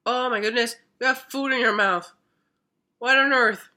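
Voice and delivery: loud, high startled exclamation; surprised. Real, not acted. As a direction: caught off guard by the pet. Pet has food in mouth.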